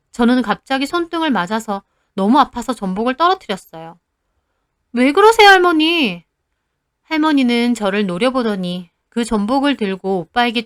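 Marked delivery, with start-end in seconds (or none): 4.9-6.2 high, loud startled protest; shocked girl crying out to her grandmother. Retold or imitated. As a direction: yelp it out after the sudden slap. Granddaughter just slapped on hand.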